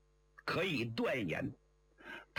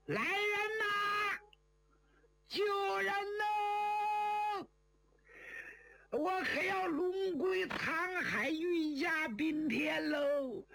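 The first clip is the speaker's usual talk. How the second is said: screaming for help. A narrator's reading. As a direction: cry out at the top of your range, stretching each call. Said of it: "张芳扯脖子就喊". (扯着脖子高声呼救)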